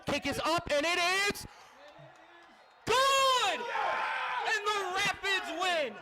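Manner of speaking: ecstatic play-by-play yell; excited shout. Real, not acted. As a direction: scream the winning kick call. Game-winning field goal call.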